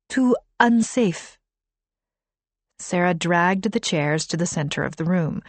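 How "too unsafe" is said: put-on higher accented translation; Arabic accent. A narrator's reading. Kahina continues translating.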